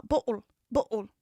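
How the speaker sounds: BOTTLE with the T swallowed, "bo'ul"; glottal stop. Shown as a demonstration. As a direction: cut BOTTLE in the middle with a catch in the throat. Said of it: "that's called a glottal stop".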